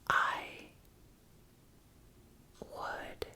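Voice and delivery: long gaps between every word; demonstrating how lost words made speech break apart; halting speech. Shown as a demonstration. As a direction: leave a long gap before each word. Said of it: "oftentimes when I would speak".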